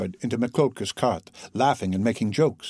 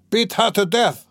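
high, heated command; cried out; 9 semitones higher than usual. A narrator's reading. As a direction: urge them on with a raised cry. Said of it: "cried Mikolka".

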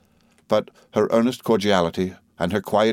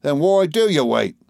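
higher-pitched, irked demand; impatient employer; 8 semitones higher, 4 dB louder than usual. A narrator's reading. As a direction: challenge a disobedient underling. Employer challenges a stubborn clerk.

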